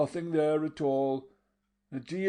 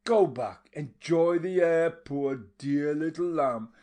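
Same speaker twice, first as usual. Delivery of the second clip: 1 taunting fury; 8 semitones higher, 7 dB louder than usual. A narrator's reading. Mattia taunting while hitting him.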